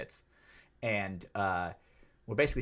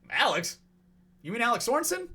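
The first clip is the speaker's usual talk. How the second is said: high, rising questioning tone; pleasantly surprised; 12 semitones higher than usual. Retold or imitated. Retelling his reply to the ref naming Alex, surprised. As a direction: pitch it up as a hopeful double-check. Retold surprise on hearing the name.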